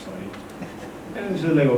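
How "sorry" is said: very soft, low apology; hushed, sheepish sorry; contrite. Retold or imitated. Husband apologizing to his wife, contrite. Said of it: "I felt bad".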